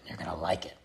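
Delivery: breathy mocking whisper. Vlogger taunting politicians, mock whisper.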